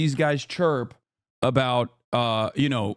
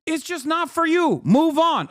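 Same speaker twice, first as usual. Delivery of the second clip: high-pitched incredulous outburst; exasperated rant. Real, not acted. Telling complainers the art is not for them, high exasperated outburst. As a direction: let exasperation push the voice way up.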